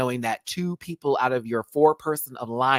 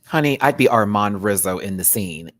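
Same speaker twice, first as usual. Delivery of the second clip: ordinary talk, nothing marked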